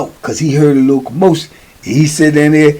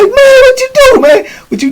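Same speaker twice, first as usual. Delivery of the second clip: very high, crying out; pained wail; 4 dB louder than usual. Retold or imitated. Burned young boy wailing at the old head, very high. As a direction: howl it through the pain. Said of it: "And he like".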